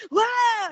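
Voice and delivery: high-pitched imitated yelp of fright; mock scream. Living person sees the ghost, mock scream. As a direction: cry out as if spooked, playfully.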